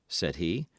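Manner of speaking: usual speaking voice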